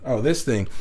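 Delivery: normal talking voice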